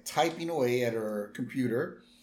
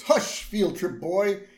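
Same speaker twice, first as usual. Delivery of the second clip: higher, louder and slow, a haughty hush; Lisa voice; 7 semitones higher, 6 dB louder, slower than usual. Retold or imitated. Lisa hushing Bart, slow, high and louder. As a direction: shush him down from a height. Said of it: "And Lisa goes".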